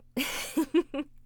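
short bright burst of laughter. Real, not acted. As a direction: laugh at the punchline. Laughs after teasing line.

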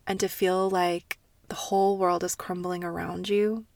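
ordinary talk, nothing marked

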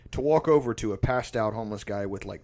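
normal talking voice

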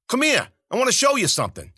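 high, loud call, rushed at the end. Acted. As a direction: shout it across to lure them. Wounded Zoot calling across road.

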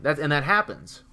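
ordinary talk, nothing marked

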